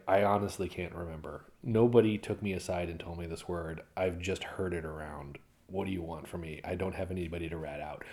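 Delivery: acted low-key innocence; quiet denial. Hypothetical denial to a parent.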